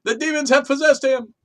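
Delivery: high dramatic voice about demons; mock possessed announcement. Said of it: "He's like".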